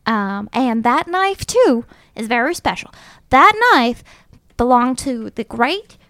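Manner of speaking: grand, swooping Dondalorian belle voice; Dondalorian accent. Acted. Disguised belle invents a history for the knife, Dondalorian accent. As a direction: spin the tale in an affected Dondalorian accent. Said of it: "I affect my best Dondalorian accent".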